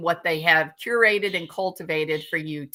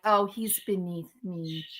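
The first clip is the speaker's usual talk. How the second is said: softer, haughty put-on voice; snooty mock voice; 7 dB quieter than usual. Streamer mocks rival's snobbery in a soft haughty voice. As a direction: sneer it like a snob. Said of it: "be like".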